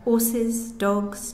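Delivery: usual speaking voice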